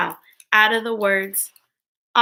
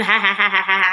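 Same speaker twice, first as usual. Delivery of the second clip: teasing laugh; much faster than usual. Real, not acted. Teasing pupils after a trick.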